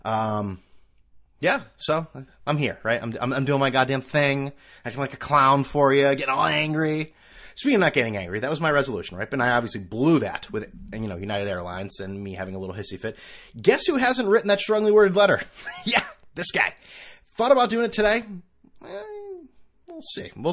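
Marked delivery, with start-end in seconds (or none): none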